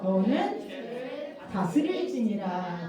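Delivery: slow, measured Bible recitation; reading aloud together. Real, not acted. Pastor leads children in the memory verse, slow recitation. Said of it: "달달 말씀인데 같이 한번 읽어봐요".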